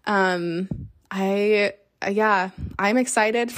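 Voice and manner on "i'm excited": normal talking voice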